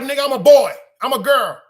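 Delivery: high, loud imitation of a friend protesting; heated mock outburst; retold loud protest. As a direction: act out a friend's shouted objection. Retold heated exchange with Meek.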